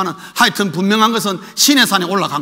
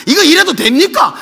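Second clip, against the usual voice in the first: higher, louder indignant question; Elijah's challenge; 7 semitones higher, 6 dB louder than usual. Retold or imitated. Preacher voicing Elijah confronting God, indignant.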